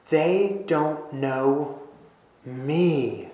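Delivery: quiet and slow, landing hard on "me"; "They don't know me" with the weight on "me"; stress on me. Shown as a demonstration. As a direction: drop the voice and lean on "me" at the end. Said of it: "me is basically bolded and underlined".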